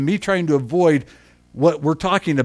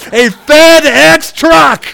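big, slow, higher shout of the punchline; shouted reveal; 9 semitones higher, 10 dB louder, much slower than usual. Acted. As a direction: hurl "A FedEx" out to the room, drawn out. Repeats the punchline to the congregation.

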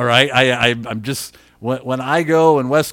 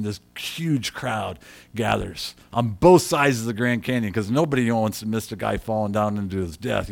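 normal talking voice

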